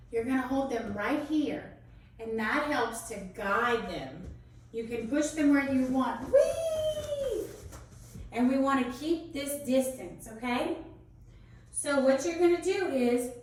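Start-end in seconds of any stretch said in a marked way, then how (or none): 6.3-7.5 high squealing whee; playful whee. Real, not acted. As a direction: squeal it for fun. Teacher playfully swinging partner.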